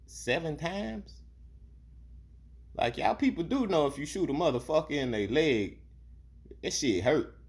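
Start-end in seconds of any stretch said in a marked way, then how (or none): 0.1-1.2 high slow disbelieving Seven times?; stunned disbelief. Real, not acted. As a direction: let the disbelief hang, slowly. Incredulous repeat.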